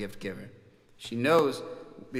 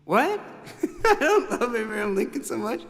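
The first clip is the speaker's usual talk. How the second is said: high, laughing protest; laughing denial; 8 semitones higher, 3 dB louder than usual. Retold or imitated. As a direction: deny it through laughter. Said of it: "And I was like".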